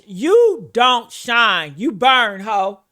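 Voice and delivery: ordinary talk, nothing marked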